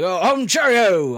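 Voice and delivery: put-on British accent, dropping at the end; jaunty British man. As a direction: play a jolly British chap. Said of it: "jaunty British man".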